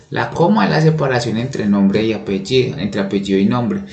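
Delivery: usual speaking voice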